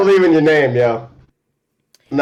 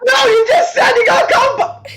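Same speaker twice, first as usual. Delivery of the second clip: laughing shout. Real, not acted. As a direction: shout it while cracking up. Laughing outburst.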